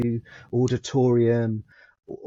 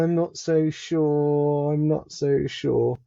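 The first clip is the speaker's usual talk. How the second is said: slow, drawn-out doubt; hesitant reluctance; 4 semitones higher, slower than usual. Retold or imitated. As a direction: stretch it out, higher, as if wavering. Acting out reluctance to return.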